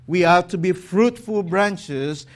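usual speaking voice

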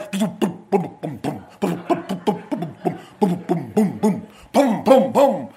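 vocal car-stereo bass thumps; booming bass imitation. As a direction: thump out a heavy bass beat with your mouth.

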